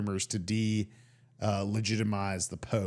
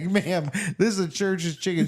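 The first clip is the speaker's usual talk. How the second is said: raised, insistent mock employee; restaurant worker; 8 semitones higher, 4 dB louder than usual. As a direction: imitate an exasperated counter guy, higher-pitched. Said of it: "the guy would be like".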